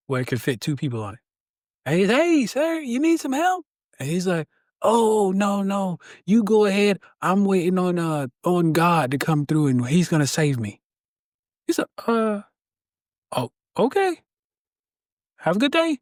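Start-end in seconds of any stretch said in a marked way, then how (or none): 2.1-3.6 much higher, friendly boat captain calling across water; rescuer calling out. Acted. Joke: rescuer calls to stranded man. 4.8-10.8 lightly voiced, higher stubborn man turning help down; a hint of a man sure God will save him; stubborn stranded man. Joke: stranded man refuses help. 12.1-16.0 much higher, shrugging boat captain; bow out politely, a bit baffled; puzzled rescuer. Acted. Joke: rescuer leaves, puzzled.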